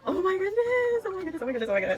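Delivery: ordinary delivery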